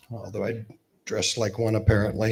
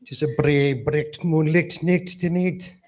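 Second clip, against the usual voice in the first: higher, thick Glaswegian brogue, words rolled together; thick Scottish accent; 6 semitones higher than usual. Retold or imitated. Best man teasing the groom's Glasgow speech, in a thick Scottish accent. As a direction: mimic a Glasgow lad reciting Burns in a thick Scottish accent. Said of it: "reciting a Robbie Burns poem with a mouthful of marbles".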